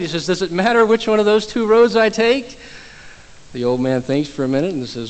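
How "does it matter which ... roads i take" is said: higher, louder exasperated retelling; very frustrated. Retold or imitated. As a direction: push the question out in exasperation. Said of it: "Very frustrated at this point".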